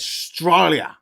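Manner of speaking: "Australia" drawn out in broad Aussie, stretched "STRY" syllable; broad Australian accent. Shown as a demonstration. Correcting co-host's "Australia" with a broad, slow Australian accent. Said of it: "You gotta get it right".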